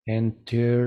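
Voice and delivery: "Enter" with the final R held and buzzing; vibrating final R. Shown as a demonstration. Teacher demonstrating vibration, held voiced R. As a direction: prolong the R of "Enter" with voice. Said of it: "vibración".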